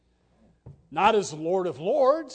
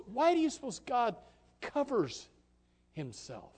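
ordinary talk, nothing marked

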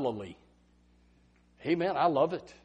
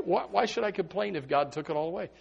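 ordinary talk

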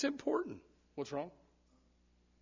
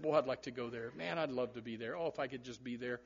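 softer, lower, dreamy yearning; wistful longing; 6 semitones lower, 9 dB quieter than usual. Retold or imitated. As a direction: sigh it out like a wish you hardly dare say. Voicing a longing congregant.

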